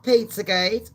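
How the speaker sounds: ordinary delivery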